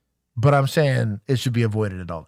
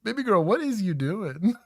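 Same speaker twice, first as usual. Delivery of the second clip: laughing, exasperated disbelief; amused disbelief; 6 semitones higher than usual. Real, not acted. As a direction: tease her grip with a laugh. Reacting to the officer's odd grip.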